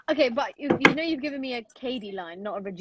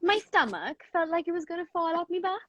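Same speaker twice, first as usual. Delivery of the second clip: put-on higher, lilting Welsh accent; 5 semitones higher than usual. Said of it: "the last line in Welsh again".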